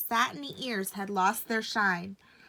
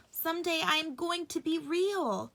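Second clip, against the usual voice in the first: higher, different toy-rabbit voice; dreamy higher rabbit voice; rabbit's inner thought; 8 semitones higher than usual. A narrator's reading. Rabbit musing while snuggled by the boy, high voice.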